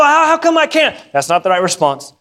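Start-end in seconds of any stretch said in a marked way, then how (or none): 0.0-1.0 high, whining challenge; hardened protest. Acted. Believer resisting God's correction, hardened whining protest. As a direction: complain as if unfairly refused. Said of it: "we get hard and say".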